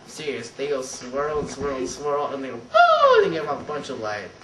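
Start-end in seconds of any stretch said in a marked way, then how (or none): 2.6-3.2 loud, high-pitched whoosh of a bulb lighting; sudden high hoot; high imitated bulb noise.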